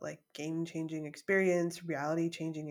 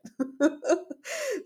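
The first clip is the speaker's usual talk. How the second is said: high laugh; 7 dB louder than usual. Real, not acted. Laughs at own fear.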